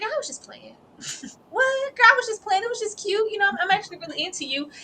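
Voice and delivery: high, light flirty backpedal, fast at the end; backpedalling Vaughn. Acted. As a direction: play it off, then flirt quickly. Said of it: "Vaughn's like".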